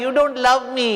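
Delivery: high, plaintive mock wail; mock crying. Hanuman crying to Ram, mock tearful. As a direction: whine it as if in tears. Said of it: "He said crying".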